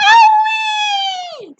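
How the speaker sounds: very high, loud yelp; Grandma yelling. A narrator's reading. Grandma hit by the medicine, high loud yelp. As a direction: yelp it out in shock. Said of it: "Grandma yelled".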